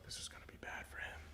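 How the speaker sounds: hushed, barely voiced whisper; whispered aside. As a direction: whisper it under your breath, as the boss. Boss's aside, retold.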